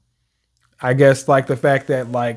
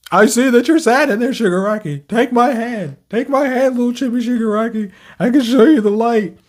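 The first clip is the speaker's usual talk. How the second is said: sweet comforting Deku; 7 semitones higher than usual. Retold or imitated. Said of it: "voicing Deku to Shigaraki".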